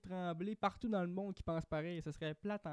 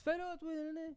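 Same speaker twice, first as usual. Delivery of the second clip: a high, lightly voiced indignant retort; mock the touchy local; lightly mocking offense; mock offended Québécois; 11 semitones higher than usual. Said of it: "on n'est pas en mode".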